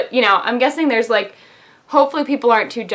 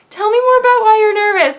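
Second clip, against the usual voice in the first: high, loud syrupy carer voice; high sweet radiologist; 11 semitones higher, 6 dB louder than usual. Retold or imitated. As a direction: coax a nervous patient sweetly.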